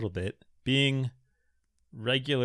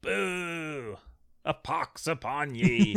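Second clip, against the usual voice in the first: high, slow archaic jeer; King James Bible English; 7 semitones higher, much slower than usual. Pretend. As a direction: curse them like an old parliamentarian. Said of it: "speaks like King James Bible English".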